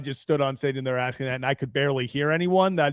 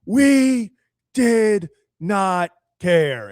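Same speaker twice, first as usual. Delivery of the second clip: much higher, louder and dragged out; mock slow yell; 9 semitones higher, 6 dB louder, much slower than usual. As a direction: shout each word slowly like at a podium. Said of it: "he's yelling".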